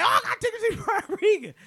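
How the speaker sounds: high-pitched gleeful mimic of a friend; Ray impression. Comedian imitating Ray bragging about his ticket, high and gleeful. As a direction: pitch it up and gloat like the lucky one. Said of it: "So Ray is saying this".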